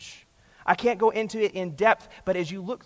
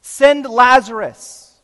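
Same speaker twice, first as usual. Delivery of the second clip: slow, louder clipped order; curt command; 6 semitones higher, 11 dB louder, much slower than usual. Retold or imitated. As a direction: demand it like a master to a servant. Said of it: "He is commanding Abraham".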